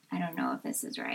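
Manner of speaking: hushed inner doubt; quiet. Retold or imitated. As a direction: murmur it low to yourself. Imagined inner doubt, quoted.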